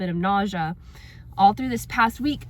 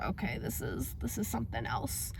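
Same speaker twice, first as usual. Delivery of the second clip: whispered, much softer, unsettled; resigned hush; 3 semitones lower, 13 dB quieter than usual. Retold or imitated. Crying for no reason, telling herself quietly. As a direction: mutter it under your breath. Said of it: "So I was like".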